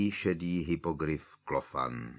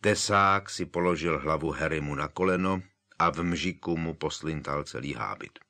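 ordinary delivery

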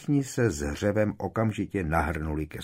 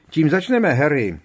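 loud, high call to Harry; eager loud call; 9 semitones higher, 11 dB louder than usual. Acted. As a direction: call it across the room. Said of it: "volal George".

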